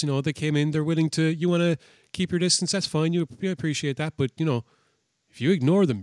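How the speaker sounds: ordinary talk, nothing marked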